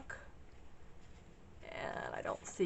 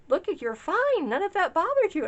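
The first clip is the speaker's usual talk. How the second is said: husband's reassurance; 11 semitones higher, 7 dB louder than usual. Retold or imitated. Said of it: "My husband was like".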